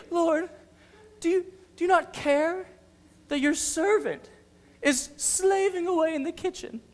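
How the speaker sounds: high, halting, put-upon plea; pleading. Acted. Martha begging Jesus for sympathy, hammed-up pleading. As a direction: play the poor overworked servant, with pauses. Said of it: "plead for Jesus's heart".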